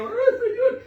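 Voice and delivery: high, loud showy cry to God; imitated over-fervent prayer cry; mock pious wail. Mocking showy worshipper wailing in church.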